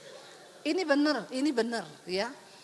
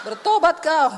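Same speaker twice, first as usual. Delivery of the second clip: loud laughing rebuke; mock rebuke; 7 dB louder than usual. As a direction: throw it back with a laugh. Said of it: "Uh, setan itu".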